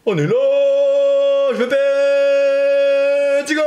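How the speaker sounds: very high, slow, stretched-out voice; drawn-out silly voice. Pretend. Drawn-out mock voice.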